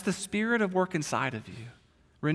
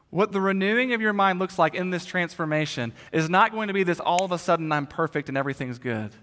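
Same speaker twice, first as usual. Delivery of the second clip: normal talking voice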